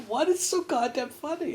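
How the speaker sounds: high, strained, mock-indignant; exasperated demand. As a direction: demand the joke, voice jumping up. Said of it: "And I'm like".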